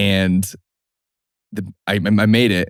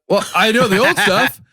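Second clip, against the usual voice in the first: put-on much higher, hopeful teen pipe-up; eager fan; 13 semitones higher than usual. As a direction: jump in like a hopeful kid. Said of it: "I'm like".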